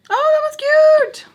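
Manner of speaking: very high, loud squeal; sudden high burst of delight; real delighted squeal. Real, not acted. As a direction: squeal over something adorable. Admiring how her items match.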